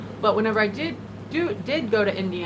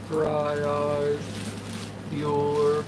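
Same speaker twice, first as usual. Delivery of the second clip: Ben Stein impression; 4 semitones lower, much slower than usual. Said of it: "I'm not great with that impression at all".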